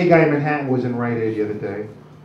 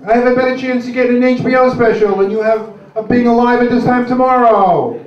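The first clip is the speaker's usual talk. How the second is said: higher, louder mock-enthusiastic verdicts; sarcastic loud pharmacist; 7 semitones higher, 5 dB louder than usual. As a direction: belt out the readings like a sneering game-show host. Pharmacist mocking customers' blood pressure.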